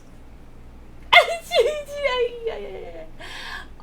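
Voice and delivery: long, high wailing squeal; drawn-out high-pitched fangirl wail. Real, not acted. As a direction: squeal at the romance, sliding slowly down. Reacting to the proposal scene.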